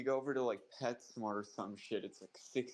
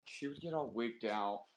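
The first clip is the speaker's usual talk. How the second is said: normal talking voice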